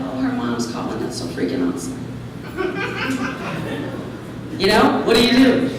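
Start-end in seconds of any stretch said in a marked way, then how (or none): 0.0-2.0 forced cheerfulness. Retold or imitated. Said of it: "I would have to be like". 2.0-4.5 soft breathy laugh. Real, not acted. After her fake-cheer quote.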